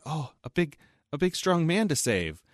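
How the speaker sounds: high, airy mock-helpless princess voice; high mock-swooning princess; mock damsel. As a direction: coo it like a fake helpless damsel. Princesses mocking damsel role.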